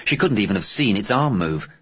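usual speaking voice

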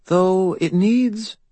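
high, slow, disdainful fey lady; fairy godmother voice. A narrator's reading. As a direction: look down your nose at him. Leah's voice.

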